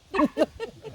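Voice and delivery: short loud laugh, pitched high; real laugh. Real, not acted. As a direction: laugh out at the surprise news. After the Yuma restaurant news.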